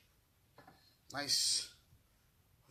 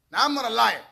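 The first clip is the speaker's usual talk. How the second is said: shouted, much louder, insisting; angry shout; 4 semitones higher, 14 dB louder than usual. Real, not acted. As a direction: yell it to prove you're honest. Defending his live water test.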